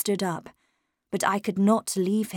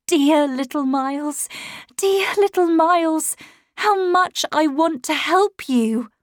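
higher, louder, building plea; pleading; 7 semitones higher, 4 dB louder than usual. A narrator's reading. Governess on her knees pleads with the boy, rising. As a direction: beg him with rising feeling.

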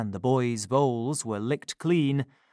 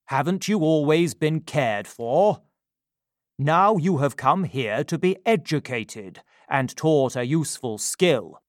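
stern, lightly voiced gentleman; 4 dB louder than usual. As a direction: address the boy with cool authority. Gentleman scolds the boy.

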